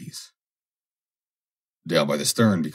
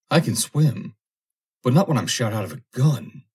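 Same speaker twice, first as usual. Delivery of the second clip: frightened protest; 6 semitones higher than usual. Acted. Foster protesting in fear.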